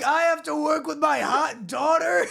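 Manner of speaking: high, badly faked Austrian shout; Arnold Schwarzenegger. Arnold dismayed at working with his daughter, bad Austrian. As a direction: exclaim it in a botched Austrian accent. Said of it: "the best Arnold Schwarzenegger impression you have".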